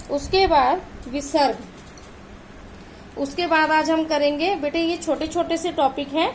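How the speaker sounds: normal talking voice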